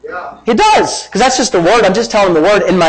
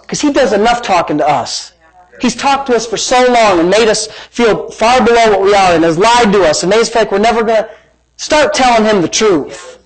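normal talking voice

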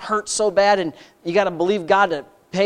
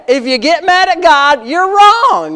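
higher, louder, preaching it harder; louder repeat; 7 semitones higher, 8 dB louder, slower than usual. Shown as a demonstration. Preacher repeating his warning, louder and higher. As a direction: drive it home even harder the second time. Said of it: "Let me say it again".

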